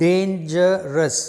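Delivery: dangerous stretched slowly across its three syllables; teacher slowing down to count the syllables in dangerous; slowly spelled out. Shown as a demonstration.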